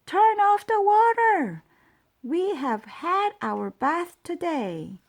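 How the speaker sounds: very high, loud angry yell; crying out. A narrator's reading. Neighbours flooded by the mouse's bath, loud high yell. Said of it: "The people in the house next door cried".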